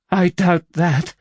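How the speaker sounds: shaky. A narrator's reading. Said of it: "she said shakily".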